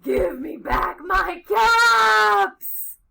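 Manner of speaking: slow, very loud, high yell; furious shout. A narrator's reading. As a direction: stretch every word into a roar. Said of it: "and he shouted".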